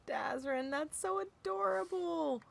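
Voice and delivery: delighted coo. Real, not acted. Cooing over a sweet comment.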